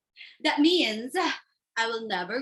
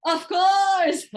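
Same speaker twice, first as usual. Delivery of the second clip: put-on drunk brother; 7 semitones higher, 5 dB louder than usual.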